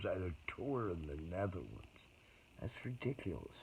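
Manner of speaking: usual speaking voice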